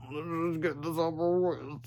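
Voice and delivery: slow, soft moan. A narrator's reading. George dreading the test day, slow soft moan. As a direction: groan it out wearily. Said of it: "said George moaned".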